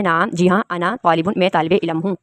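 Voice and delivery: ordinary talk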